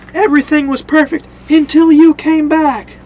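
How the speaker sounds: loud, high-pitched angry accusation; acted accusing shout. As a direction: yell the blame at him. Shane blames Rick, retold.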